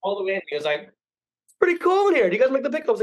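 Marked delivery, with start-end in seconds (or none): 1.5-3.0 very high, louder, breezy customer; clueless customer, hammed-up and high; mock customer voice. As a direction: play the oblivious guy, way up high. Reenacting customer in kitchen.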